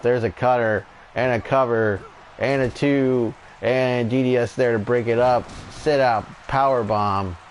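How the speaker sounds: steady wrestling commentator call; live play-by-play. Acted. Commentator calling the tag match for viewers, play-by-play. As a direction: call each move as it lands.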